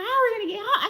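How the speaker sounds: put-on a scared girl stranded late at night; freaking out. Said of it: "Keisha freaking out".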